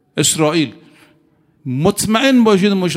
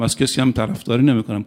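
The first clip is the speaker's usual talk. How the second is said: ordinary talk, nothing marked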